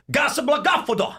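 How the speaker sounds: foreign gibberish. Retold or imitated. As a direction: babble nonsense like a foreigner, up high. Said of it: "you don't speak the same language".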